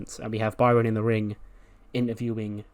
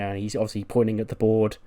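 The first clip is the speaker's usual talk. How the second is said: ordinary talk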